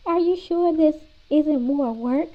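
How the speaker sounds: teasing mother. A narrator's reading. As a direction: poke fun at the kids' eagerness. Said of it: "teased Mom".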